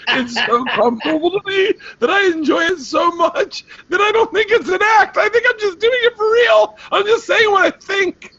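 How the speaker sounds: high squealing, laughing shout; real helpless laughter pushing the words into a squeal; laughing confession. Real, not acted. Comedian admitting his character is real, cracking up.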